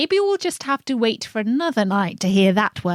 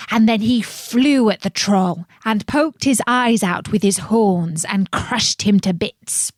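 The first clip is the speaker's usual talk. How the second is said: ordinary delivery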